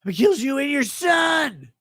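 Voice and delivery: shout. Acted. Shouting at start of stream.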